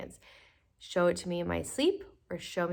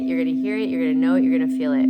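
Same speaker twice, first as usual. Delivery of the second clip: loud; 14 dB louder, faster than usual. Acted. Emphatic repeated promise.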